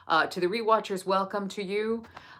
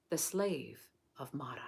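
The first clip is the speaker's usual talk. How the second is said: whispered; 4 semitones lower, 7 dB quieter than usual. Acted. Closing line of the reading.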